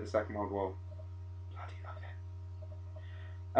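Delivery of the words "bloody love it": slow, hushed whisper. Acted. History boffin confessing love of the war, slow whisper. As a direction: whisper it as a gloating aside. Aside after gushing about wars.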